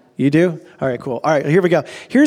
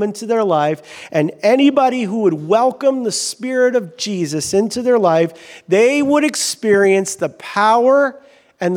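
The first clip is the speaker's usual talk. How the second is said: higher-pitched, rousing sermon delivery; emphatic preaching; 5 semitones higher, slower than usual. Real, not acted. Preacher telling the congregation about the Spirit, rousing preaching. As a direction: preach it with stretched, ringing key words.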